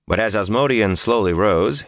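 ordinary delivery, nothing marked

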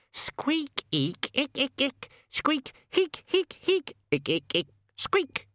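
very high chattering squeaks; rat squeaks. Acted. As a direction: squeak and chitter excitedly. Death of Rats squeaks a story.

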